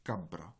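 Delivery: cabra with a long b: ca-bbra; long b demo. Shown as a demonstration. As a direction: lengthen the b in cabra. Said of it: "un be qui est allongé".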